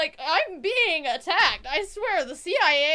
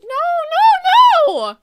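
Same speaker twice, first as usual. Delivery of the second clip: high, loud cry of refusal; hammed-up wail; 14 semitones higher, 17 dB louder, much slower than usual. Player begging Neil not to end it, hammed-up wail. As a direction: wail it in mock despair. Mock despair at character's rejection.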